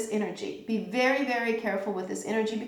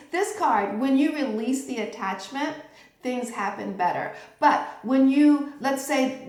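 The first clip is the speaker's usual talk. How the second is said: normal talking voice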